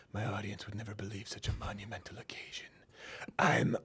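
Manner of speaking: hushed, breath-heavy action star impression; Steven Seagal. Host quoting an action star on his own greatness, hushed impression. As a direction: whisper it with grand self-importance. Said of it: "there's an actual quote".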